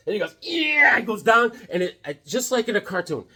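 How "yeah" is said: high, loud axe-swing cry; effortful yell. Acted. Brother swinging an axe at wood, high effort yell.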